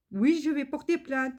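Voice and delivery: high mocking voice of the courier; imitating the courier. Customer mimics the courier threatening a complaint. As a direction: toss it off like a sulky threat. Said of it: "Je dis".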